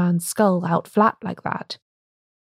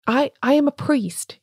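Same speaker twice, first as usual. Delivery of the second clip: higher, stammering cry; unsteady cry; 6 semitones higher than usual. A narrator's reading. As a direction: stumble over the first word, voice unsteady. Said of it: "he cried unsteadily".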